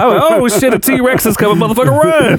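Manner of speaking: high panicked yelling; hammed-up panic, fleeing; mock panic. Imagined T-Rex chase, panicked.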